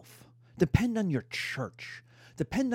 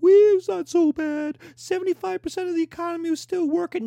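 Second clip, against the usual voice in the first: high, loud, fast mocking fanboy voice; 13 semitones higher, 7 dB louder, faster than usual. Historian mocking fanboys downplaying the Depression, high and loud. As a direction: squeal it like a smug know-it-all. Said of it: "the fanboys like".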